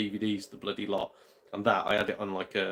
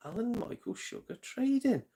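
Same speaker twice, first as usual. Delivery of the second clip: higher, drawn-out Alan Michael Sugar Trading as it clicks; realising aloud; 12 semitones higher than usual. Real, not acted. Realising Amstrad's name to the chat, excited. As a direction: say it like the penny just dropped. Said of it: "Hence, Amstrad".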